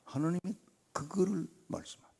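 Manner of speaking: ordinary delivery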